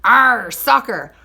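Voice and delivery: loud, slow pirate cry; gruff pirate yell. A narrator's reading. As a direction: yell it kicking the ball.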